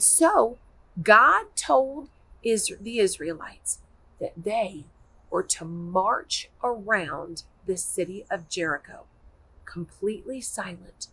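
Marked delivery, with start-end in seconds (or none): 5.3-11.1 hushed. Acted. Describing the silent march.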